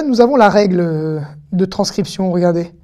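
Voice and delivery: ordinary delivery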